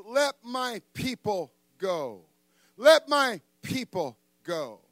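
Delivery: slow, high, stretched-out decree; measured, ringing demand; solemn proclamation. Acted. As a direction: proclaim it slowly to a ruler. Moses confronts Pharaoh.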